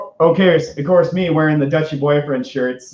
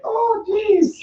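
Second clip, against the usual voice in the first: nervous Morty. Acted. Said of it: "I'm just like".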